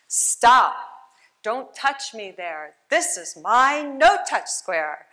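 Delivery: loud, firm stop command; girl's firm shout. Retold or imitated. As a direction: shout it out like a brave little girl. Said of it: "she goes like this".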